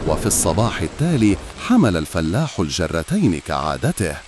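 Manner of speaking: ordinary delivery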